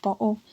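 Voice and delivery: "bottle" with the t swallowed in the throat; "bottle" said as bo'le; glottal stop. Shown as a demonstration. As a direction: cut "bottle" with a catch, no t. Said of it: "So there's a stop there".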